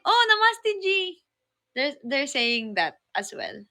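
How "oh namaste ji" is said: lightly imitating Indian greeters, higher; a playful higher Namaste ji; mock Indian greeting. Friends recount locals greeting them, mock Indian greeting.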